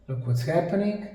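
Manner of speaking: normal talking voice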